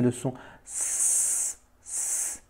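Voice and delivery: a long unvoiced hiss, sss; stretched hissing S; hissed S sound. Shown as a demonstration. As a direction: hiss the S like a snake. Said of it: "C'est le son du S français".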